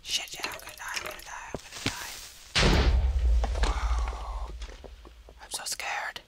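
unvoiced breath, whispering in fear; real scared whisper. Real, not acted. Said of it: "I'm whispering".

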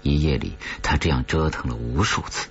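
plain reading voice

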